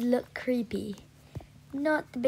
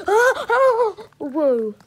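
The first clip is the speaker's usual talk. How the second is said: sudden loud startled oh; nearly falling off, yelp it; startled gasp; 7 semitones higher, 8 dB louder, much slower than usual. Real, not acted. Rider almost falls off her horse, startled cry. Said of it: "I literally just almost fell".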